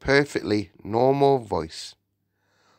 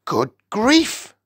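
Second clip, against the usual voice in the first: high, loud exclamation of shock; shocked cry; 13 semitones higher, 5 dB louder than usual. A narrator's reading. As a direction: cry out in startled horror. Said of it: "he cried".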